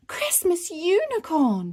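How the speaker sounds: thrilled high-pitched exclamation; excited cry. Acted. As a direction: cry out in wonder like a little girl. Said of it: "she cried".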